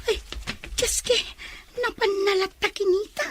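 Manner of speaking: hushed shocked aside. Acted. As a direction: gasp it under the breath.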